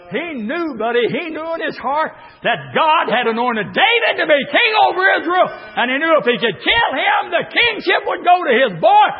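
shouted, high preaching cadence; preaching at full force. Acted. Preacher shouting to the congregation, full force. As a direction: preach it out to the church.

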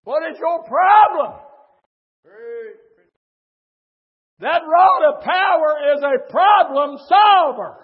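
fiery pulpit shouting; full-force preaching. Real, not acted.

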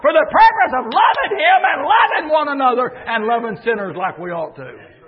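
high, loud, shouted preaching cry; full-force preaching. Acted. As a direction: lift the church with a ringing shout.

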